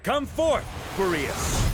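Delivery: high ringing call to summon a spirit; commanding summons. Acted. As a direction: call the spirit forth with full voice.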